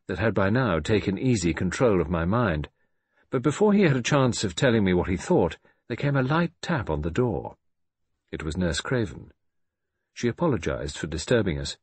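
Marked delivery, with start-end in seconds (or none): none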